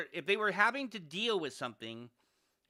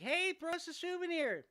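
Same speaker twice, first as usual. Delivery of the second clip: high-pitched mock call from afar; imitated yell down; 14 semitones higher than usual. Boaters yell down to passengers on deck, high mock holler. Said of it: "they would yell down".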